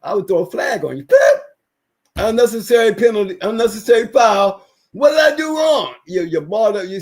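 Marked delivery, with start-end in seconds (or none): 1.0-1.4 sharp mouth whistle like a ref; whistle noise. Pretend. Pretend referee stops the argument with a whistle. 2.2-4.7 loud, mock referee calling a foul. Pretend referee penalizing both arguers, loud call. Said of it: "I would be the referee in the ring". 4.9-5.9 high, loud mock whine of the penalized player; mock protest. Flagged dog owner protests the referee's call, high mock whine.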